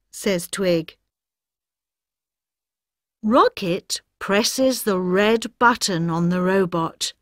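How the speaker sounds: ordinary delivery, nothing marked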